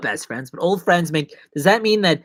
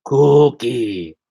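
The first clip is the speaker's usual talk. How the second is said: cookie monster-like growl; 3 semitones lower, 5 dB louder than usual. Pretend. Showing off cookie to chat.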